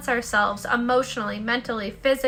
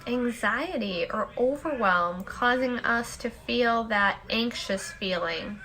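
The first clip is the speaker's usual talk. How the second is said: guided meditation; 4 semitones lower, 6 dB quieter than usual. Acted. Host leading listeners in the daily peace break, soft meditation voice. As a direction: guide them into stillness, unhurried and hushed.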